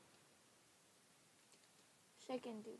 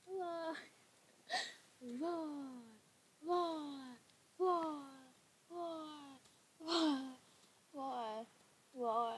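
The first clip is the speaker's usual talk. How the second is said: higher-pitched playful roars, one by one; pretend roar; 4 semitones higher, much slower than usual. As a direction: roar like a small animal, over and over. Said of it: "I just roared the whole entire".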